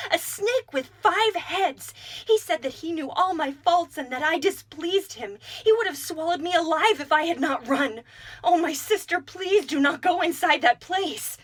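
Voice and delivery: high, loud terrified rush of words; frightened pleading. A narrator's reading. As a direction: warn her sister in panic. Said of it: "she fell upon her sobbing".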